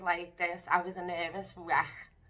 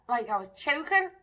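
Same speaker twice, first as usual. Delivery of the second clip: nervous laugh; 11 semitones higher, 10 dB louder than usual. Real, not acted. Laughing at her own story.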